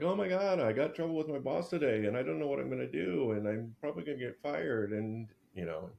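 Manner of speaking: high, fast, panicky rush; freaking out. Retold or imitated. A man freaking out to his date about his boss. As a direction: gush out the worry like a man in a panic. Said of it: "you freak out and you're like".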